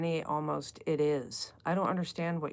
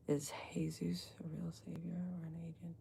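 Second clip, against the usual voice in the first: breathless whispered question; hushed, unvoiced reading of the chat; 8 dB quieter than usual. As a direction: whisper it like a secret.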